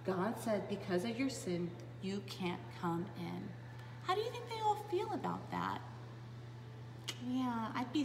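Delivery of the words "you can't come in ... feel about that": usual speaking voice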